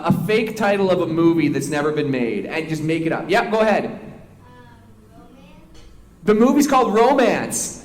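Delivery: normal talking voice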